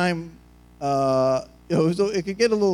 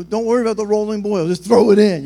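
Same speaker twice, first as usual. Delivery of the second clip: louder, urgent push; rushing impatience; 4 semitones higher, 8 dB louder than usual. Acted. Hungry people rushing the pasta, impatient urging. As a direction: urge it on, building to a loud finish. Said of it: "we're rushing it".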